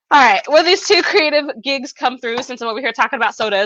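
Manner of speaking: ordinary talk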